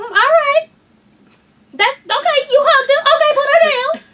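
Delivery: high, loud nervous insistence; high tense mock-polite urging; anxious mother. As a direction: hurry them to hand the baby back. Anxious mother taking baby back.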